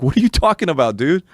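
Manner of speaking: mock-exasperated question to the alien; exasperated disbelief. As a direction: push it up in pitch, incredulous. Baffled reply escalates, retold.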